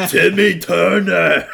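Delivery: high, drawn-out cartoon cry; impression of a cartoon shout. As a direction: stretch it out, high and loud. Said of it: "do my, uh, impression".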